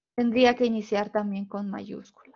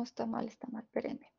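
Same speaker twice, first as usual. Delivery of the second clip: whisper; 13 dB quieter than usual. Real, not acted. Teacher's aside, wrong screen.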